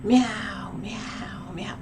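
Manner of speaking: imitated cat meow. Cat waking its owner, imitated insistent meowing. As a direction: mimic a cat that keeps interrupting. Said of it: "my cat kept going".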